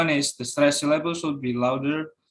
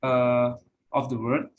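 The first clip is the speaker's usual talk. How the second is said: ordinary delivery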